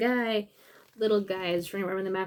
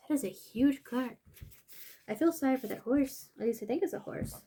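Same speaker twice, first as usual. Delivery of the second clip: ordinary delivery, nothing marked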